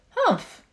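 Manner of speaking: high, sharp humph; a baby echoing its mother's sneer; little scornful humph. A narrator's reading. Young kangaroo copying its mother, high humph. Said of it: "the young kangaroo in her pouch said".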